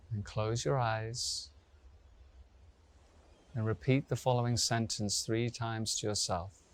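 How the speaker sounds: slow, spacious relaxation voice with long pauses; guided meditation. Real, not acted. Yoga teacher settles students lying down, slow meditation guidance. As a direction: guide them gently into stillness, unhurried.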